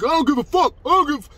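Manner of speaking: high-pitched, shouted mockery of an aggressor; loud, brash imitation. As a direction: mimic his belligerent yelling.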